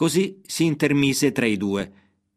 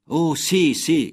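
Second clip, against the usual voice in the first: higher, eager cry of relief; relieved cry; 5 semitones higher, 3 dB louder, much slower than usual. A narrator's reading. Debtor to his rescuing friend, eager relieved cry. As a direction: blurt out yes with sudden hope. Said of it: "gridò il debitore".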